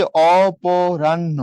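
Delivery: অপরাহ্ন stretched very slowly, sound by sound; drawn-out syllables. Shown as a demonstration. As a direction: draw out each syllable of অপরাহ্ন. Spells out word while writing.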